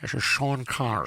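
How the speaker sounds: slurry Scottish movie-star impression; put-on Scottish burr; Sean Connery. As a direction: try out a Scottish film-star voice. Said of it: "I could do an impression of".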